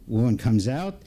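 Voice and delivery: plain reading voice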